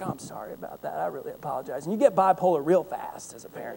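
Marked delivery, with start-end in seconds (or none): 0.0-1.8 much softer, sheepish sorry; sudden apology. Retold or imitated. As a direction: drop to a meek apology after yelling. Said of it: "and you're like".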